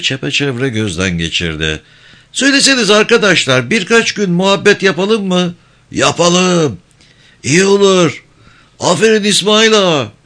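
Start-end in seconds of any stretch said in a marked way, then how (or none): 2.3-5.5 high, sly village elder; holding court with mock gravity; sly old man speech. Topal İsmail asks crowd. 5.9-10.1 high, eager villagers' reply; crowd agreeing. Acted.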